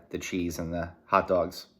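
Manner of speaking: ordinary delivery, nothing marked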